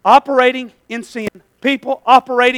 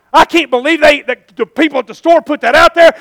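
louder, higher griping; mock complaining; 6 semitones higher, 9 dB louder than usual. Preacher mimicking a churchgoer griping about others, louder. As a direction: whine about other people's sins.

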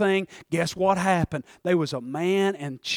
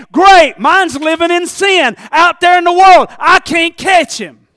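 loud, climbing sermon cry of frustration; fiery preaching; 8 semitones higher, 15 dB louder than usual. Acted. Preacher crying out to the church about an unsaved son. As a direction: preach it hard, voice straining high.

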